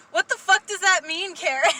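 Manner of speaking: loud, high comic outrage; indignant outburst. Retold or imitated. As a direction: demand an answer, loudly acting affronted. Acts indignant reply to backhanded compliment.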